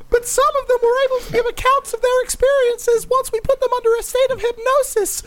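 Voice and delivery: very high squeaky cartoon mouse; Disney Mouse voice. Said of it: "been the Disney Mouse".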